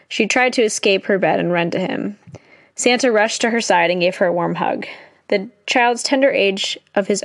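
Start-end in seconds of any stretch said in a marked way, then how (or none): none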